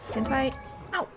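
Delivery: ordinary talk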